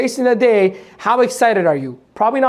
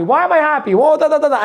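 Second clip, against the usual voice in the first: "Why am I happy?" asked higher and brighter, savoring the I; delighted wondering question; 6 semitones higher than usual. Shown as a demonstration. Coach urging the audience to savor happiness, mock-delighted higher voice. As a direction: ask "Why am I happy?" with high pleased curiosity. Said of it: "Maybe that's what you should do".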